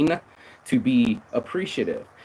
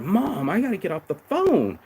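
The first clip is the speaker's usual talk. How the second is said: higher, exasperated brush-off; impatient son; 8 semitones higher than usual. Retold or imitated. Son trying to end a long call with mom, exasperated. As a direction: cut her off impatiently. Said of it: "I was like".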